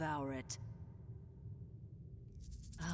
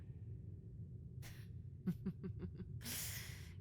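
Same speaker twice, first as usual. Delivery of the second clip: quiet airy laugh through the nose; hushed mocking giggle; soft breathy villain chuckle; 10 dB quieter than usual.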